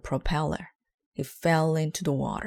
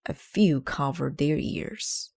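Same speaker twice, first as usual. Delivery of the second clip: ordinary delivery, nothing marked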